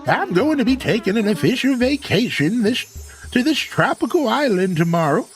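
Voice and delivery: put-on high, drawn-out old-timer voice; old tortoise character. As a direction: play a chummy old tortoise mayor. Voices Tortimer in the game.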